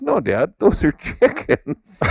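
put-on a little boy giggling through his correction; imitating his son laughing.

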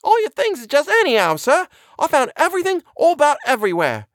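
very high-pitched, flustered housekeeper; excited cry. A narrator's reading. Housekeeper reporting burglars to the doctor, excited cry. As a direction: cry out the news in a high put-on voice. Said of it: "she cried excitedly".

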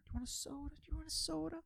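very high, soft mock salesman voice; sing-song high salesman offer; pushy salesman offering soda. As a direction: coo the offer like an over-eager dealer. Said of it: "they wanna give you drinks".

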